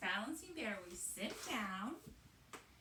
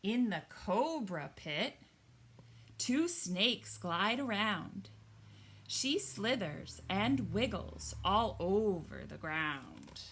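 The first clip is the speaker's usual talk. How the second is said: loud, slow picture-book reading; story reading; 2 semitones lower, 7 dB louder, slower than usual. Acted. Teacher reading the snake page aloud, storytelling voice. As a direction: read the cobra page like a storybook.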